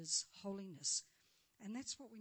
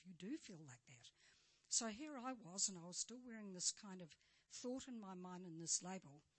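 usual speaking voice

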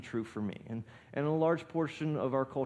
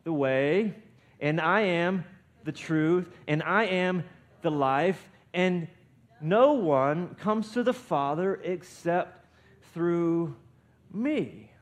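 loud, slow phrases with pauses between; raised, emphatic scripture declaration; leading group recitation; 4 semitones higher, 7 dB louder, slower than usual. Real, not acted. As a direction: proclaim it piece by piece for all to join in. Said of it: "let's say it together".

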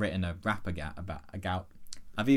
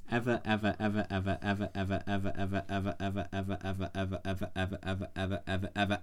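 softer and softer chant of ever; fading ever; 3 semitones lower, 6 dB quieter, faster than usual. Acted. Narrator drags out ever, fading away. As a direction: let each ever trail off quieter.

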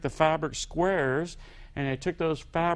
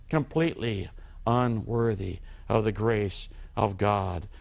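ordinary talk

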